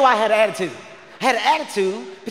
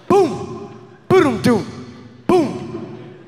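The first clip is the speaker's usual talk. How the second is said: trunk thumps; 4 semitones higher, much slower than usual. Retold or imitated. Imitating trunk noises.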